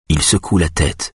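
usual speaking voice

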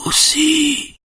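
extremely high, thin drawn-out squeak; shrill, strangled. A narrator's reading. Terrified Schulman struggles to name someone, shrill voice. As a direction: squeeze the word out in falsetto.

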